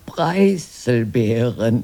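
slow, drawn-out, clearer answer. Acted. Sister obeying her brother's order to speak up, slow and clear. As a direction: drag it out obediently, loud enough at last. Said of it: "Lauter, Greta".

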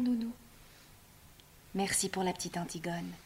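soft, hushed comfort; tender whisper. Acted. Antigone gently comforts her weeping nurse in a whisper. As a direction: soothe her tears.

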